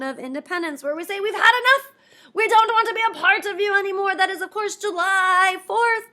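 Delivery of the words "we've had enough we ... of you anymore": loud, high, fast outburst; defiant declaration. Acted. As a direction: tell the king off with passion. Colonists declaring independence.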